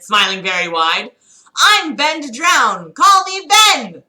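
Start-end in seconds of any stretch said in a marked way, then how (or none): 1.6-4.0 slow, loud, higher cheerful intro; cheerfully. Acted. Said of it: "cheerfully said".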